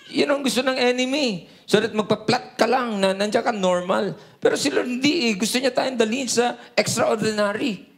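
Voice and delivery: ordinary delivery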